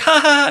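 high, loud fake champagne laugh; mock laughter. Critic mocking lovers pouring champagne, loud fake laughter. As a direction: imitate giddy lovers laughing, hammed up. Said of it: "they just keep laughing".

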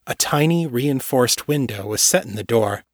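usual speaking voice

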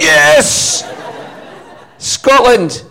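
very high, loud whooping cheer with a laugh. Retold or imitated. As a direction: whoop like the whole crowd going wild. Crowd cheering, he imitates it.

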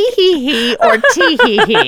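high, loud mock child giggles; child's giggle. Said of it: "Mom, what's more realistic?".

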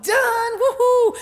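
high, loud celebratory woohoo; elated. Retold or imitated. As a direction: whoop with joy at being finished. Celebrating the last day of class.

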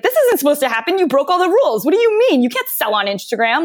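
high, incredulous mock disbelief; shrill-free high mock shock; mock outrage. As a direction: act scandalized at the broken rule. Everyone shocked at rule breaking.

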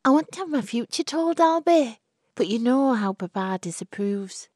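high, fast wheedling plea; pleading young woman. Acted. Florrie coaxes Albert about her future, high and fast. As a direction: coax him like a spoiled girl.

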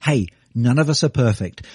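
usual speaking voice

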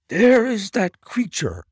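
high, loud startled cry at a shape; high loud cry of alarm. A narrator's reading. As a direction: shout it out in alarm at the shadow. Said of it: "cried Grant Munro".

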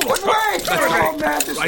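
panicked pleading. Acted. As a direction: beg for your life. Haman dragged off by guards.

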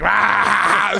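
short high exclamation; a quick playful yelp; playful "ah" yell; mock shout. Guy reacts to the joke.

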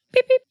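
very high squeaky beep-beep; mock beep sound effect. Shown as a demonstration. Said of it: "Here's the blooper sound effect".

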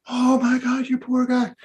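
high-pitched gush of sympathy; gasping pity, acted for a whole crowd; shocked compassion. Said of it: "this compassionate group of people".

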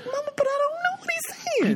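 high, fast, whining plea to Mama; whiny plea. Retold or imitated. A daughter whining to Mama that she can't understand him, high and fast. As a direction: whimper it like a scolded kid. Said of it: "I was like".

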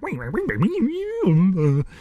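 silly nonsense noises, pitch jumping; fake foreign language. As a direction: gabble meaningless sounds like fake Japanese. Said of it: "He's just making loud noises".

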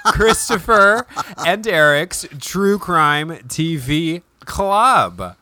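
slow, stretched show title; drawn-out announcer title. Acted. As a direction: announce the title grandly, slowly.

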